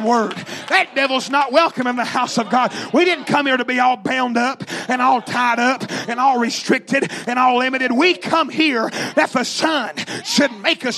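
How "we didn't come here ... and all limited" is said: normal talking voice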